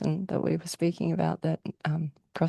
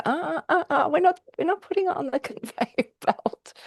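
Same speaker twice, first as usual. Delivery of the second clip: high-pitched "uh-uh" veto; playful refusal; 9 semitones higher than usual. Acted. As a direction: turn it away in a high, playful voice. Voices inner refusal of a message.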